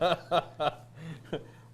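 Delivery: loud real laughter; laughing along. Real, not acted. Cohost laughing with host over the finger-pointing joke, loud laughter.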